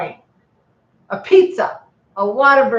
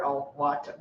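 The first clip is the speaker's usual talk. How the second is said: ordinary talk, nothing marked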